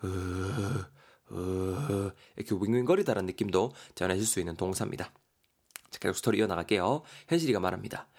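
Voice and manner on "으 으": low whooshing hum; wind whoosh. Shown as a demonstration. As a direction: imitate the wind humming. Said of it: "바람이 이렇게".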